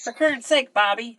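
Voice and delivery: a light Minnesota mom scolding Bobby; Minnesota mom accent. Retold or imitated. Imitating the cartoon mom to a co-host, light Minnesota accent. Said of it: "Fargo-ish accent".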